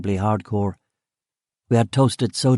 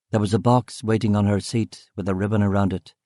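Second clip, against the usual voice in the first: plain reading voice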